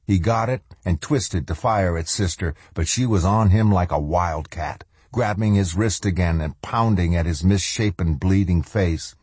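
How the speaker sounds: ordinary delivery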